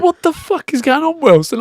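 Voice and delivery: imitating the roused roommate's protest; grumpy outburst. Woken roommate's angry outburst voiced.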